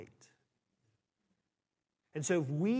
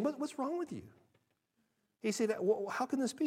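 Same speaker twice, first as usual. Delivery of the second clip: imitating a baffled apostle, pitched up; shocked disbelief; 6 semitones higher, 3 dB quieter than usual. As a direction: ask it in mock disbelief. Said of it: "the reason he's so shocked".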